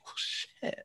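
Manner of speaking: whispered "Well, shit" under the breath; hushed deflated curse; whispered resignation. Retold or imitated. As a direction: let it out as a defeated whisper. Own deflated thought retold.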